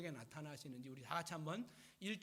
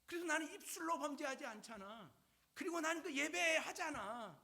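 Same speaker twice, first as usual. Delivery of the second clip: higher-pitched acted protest, dying away at the end; aggrieved pleading; 10 semitones higher than usual. Job defending his faith to his friends, aggrieved pleading. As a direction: plead your case, exasperated.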